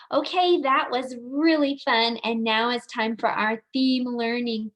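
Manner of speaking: ordinary delivery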